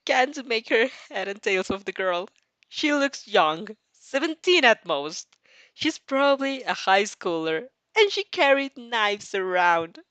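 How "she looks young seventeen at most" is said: ordinary delivery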